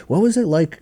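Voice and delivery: high-pitched, eager question; eager curiosity. Retold or imitated. Nephew phoning aunt about her childhood, eager. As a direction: ask it with keen, lifted curiosity.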